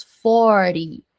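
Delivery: "forty" with the T softened to a tap, like fory; FORTY, T not sounded. Shown as a demonstration. Teacher introduces forty to students, T dropped. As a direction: slowly, skip the hard T in "forty". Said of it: "ignora aqui o T".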